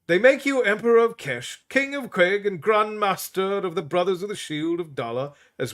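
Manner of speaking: put-on high, fussy old priest voice; a stern old healer shooing visitors; high-pitched voiced old priest; old Tully. A narrator's reading. Tully scolding the king.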